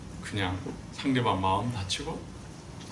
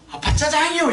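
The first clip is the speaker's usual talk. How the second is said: put-on higher, louder, a boyish eager reply; the son's answer; 7 dB louder than usual. Preacher voicing the son asking for jajang, eager. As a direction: answer like a hungry teenage son. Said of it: "이렇게 대답해요".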